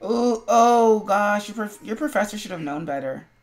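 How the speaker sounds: ordinary talk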